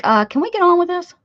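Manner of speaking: high, loud, fast impatient voice; impatient viewer. Retold or imitated. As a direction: whine like a bored viewer. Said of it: "Y'all are like".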